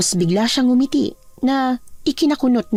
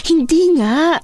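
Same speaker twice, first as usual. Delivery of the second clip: high, raised-voice denial; exasperated denial; 7 semitones higher, 4 dB louder, slower than usual. Acted. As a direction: snap back in frustration. Exasperated denial of eating people.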